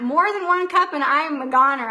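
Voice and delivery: ordinary talk, nothing marked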